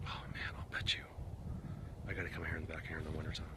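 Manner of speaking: hushed excited whisper; quick, unvoiced aside. Real, not acted. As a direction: breathe it out to yourself, fast.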